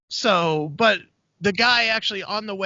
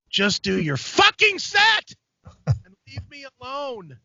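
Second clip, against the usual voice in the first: exasperated outburst; 7 semitones higher, slower than usual. Retold or imitated. Audience member begging comedian to continue, retold exasperation. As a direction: explode on the middle words, then plead.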